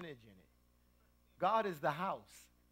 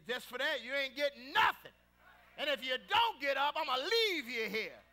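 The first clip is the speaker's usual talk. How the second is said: Big Mama; 8 semitones higher, 6 dB louder than usual. Retold or imitated. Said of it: "But Big Mama would say".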